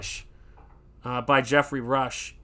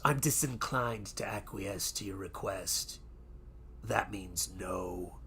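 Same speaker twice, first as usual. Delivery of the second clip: low, very soft, slow pirate captain; imitating Barbossa; 5 semitones lower, 10 dB quieter, slower than usual. Host quotes the captain refusing parley, low slow quiet voice. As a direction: savour each word in a low, quiet menace. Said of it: "he goes".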